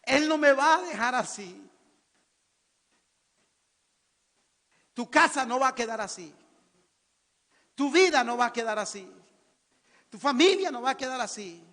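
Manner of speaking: high, loud, fervent proclamation; impassioned pulpit shout; full-force preaching. Real, not acted. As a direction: thunder the promise out to the congregation.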